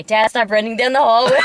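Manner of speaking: loud retold holler; exasperated shout, acted out; shouting at dad. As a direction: holler it down the hallway at your father. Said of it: "I usually say".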